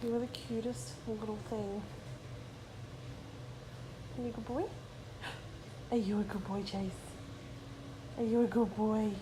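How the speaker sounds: soft cooing to a dog; doting pet talk. Real, not acted. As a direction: praise your dog in a tender little voice.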